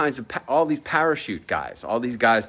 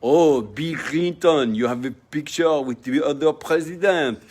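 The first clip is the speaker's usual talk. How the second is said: teasing chef. Retold or imitated. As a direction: lightly mimic a chef ribbing his friend. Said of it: "He would always call me".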